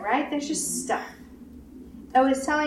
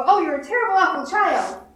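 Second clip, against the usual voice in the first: higher, louder mock berating; mock scolding parent; 7 semitones higher, 5 dB louder than usual. Parent berating the hurt child, mock angry. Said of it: "I don't go".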